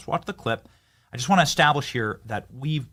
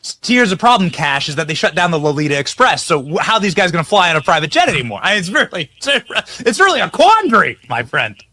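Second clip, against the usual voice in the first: loud, high sarcastic outrage; mock outrage; 7 semitones higher, 8 dB louder than usual. Real, not acted. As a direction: ham up the fake dismay. Host mock-outraged rant, very loud.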